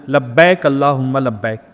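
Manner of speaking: slow, clearly voiced talbiyah; a slow, audible devotional recitation; spoken aloud recitation. Shown as a demonstration. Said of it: "منہ سے بولنا ہے".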